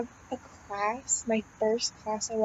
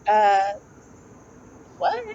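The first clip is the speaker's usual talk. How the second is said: mock confused teacher; 7 dB louder than usual. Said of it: "Like, so confused".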